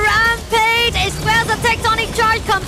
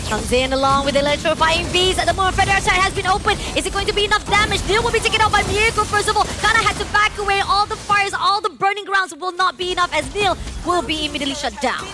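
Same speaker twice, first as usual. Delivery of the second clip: fast, raised esports call; live play-by-play; 6 semitones higher, 3 dB louder than usual. Acted. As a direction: call the fight as it unfolds.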